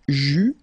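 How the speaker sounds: "ju" with French u, no final S; a loud, clear "ju" ending on the vowel; silent final S. Shown as a demonstration. Teacher modelling a verb form for learners, silent final S. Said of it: "final S not pronounced".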